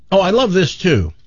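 usual speaking voice

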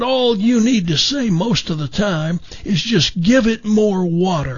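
easygoing gardener handing out simple advice; Jim McMillan impression. Jim advising Frank on callers, in slow raspy southern voice. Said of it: "kind of a raspy voice, a little southern accent".

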